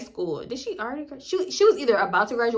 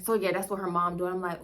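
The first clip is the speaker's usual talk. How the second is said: usual speaking voice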